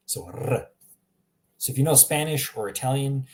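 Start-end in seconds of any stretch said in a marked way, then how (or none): 0.3-0.7 "r" as a tongue-tip trill; rolled R. Shown as a demonstration. Teacher repeating the Croatian sound, trilled "r". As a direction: roll the "r" on its own. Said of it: "in Croatian, it's r".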